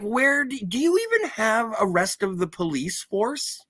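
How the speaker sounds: mock incredulity. Incredulous reaction retold, high pitch.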